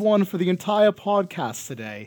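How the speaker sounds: loud, high-pitched fake British accent; hammed-up British accent. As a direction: propose it in a bad put-on British voice. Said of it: "was mine terrible?".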